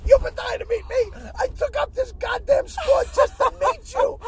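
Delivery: high, loud, excited disbelief; star-struck disbelief. Retold or imitated. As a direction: gush over meeting your idol. Star-struck retelling meeting his idol.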